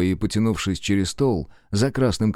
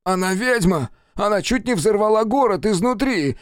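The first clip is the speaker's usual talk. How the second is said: much higher, outraged protest; indignant; 11 semitones higher than usual. Acted. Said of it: "возмутился Джейкоб".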